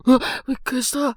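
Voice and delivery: sudden high, loud, fast jolt of fright; loud startled cry, words tumbling out; startled yelp. Acted. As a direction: jump out of your skin. Startled by face peeking in.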